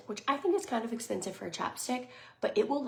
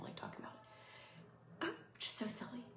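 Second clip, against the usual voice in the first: choked up; 5 semitones higher, 12 dB quieter than usual. Real, not acted. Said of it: "I feel emotional".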